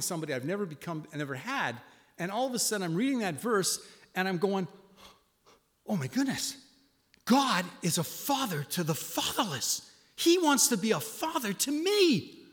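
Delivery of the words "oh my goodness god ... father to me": rising wonder, high and slow; awestruck. Retold or imitated. Alone in bed, reading the psalm, awestruck and rising. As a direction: let the discovery overwhelm you.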